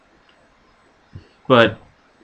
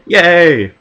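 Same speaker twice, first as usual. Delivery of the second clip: mock cheer; 6 semitones higher, 8 dB louder than usual. Mock cheer at grim fate.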